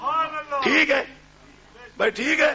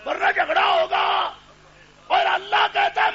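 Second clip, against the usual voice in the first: higher, louder sermon cry, fading at the end; fiery preaching; 9 semitones higher, 5 dB louder than usual. Acted. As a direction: preach it out to the crowd. Preacher at full force.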